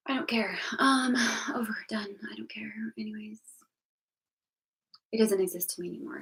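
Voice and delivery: sighing, near-tearful whisper of giving up; resigned whisper. Real, not acted. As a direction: murmur it like you're done with everything. Resigned over bad year.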